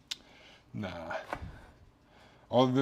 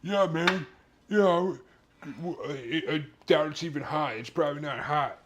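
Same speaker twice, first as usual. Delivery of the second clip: cocky friend; 5 semitones higher than usual. Retold or imitated. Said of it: "And he's like".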